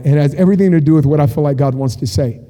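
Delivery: ordinary delivery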